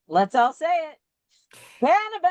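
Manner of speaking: rally everyone to shout the word; reading out the cheer. Retold or imitated. Host reads a viewer's call to say it together, high rallying shout. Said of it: "Justin Mooney says".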